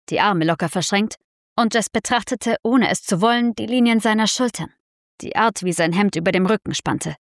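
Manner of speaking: ordinary delivery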